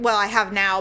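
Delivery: ordinary talk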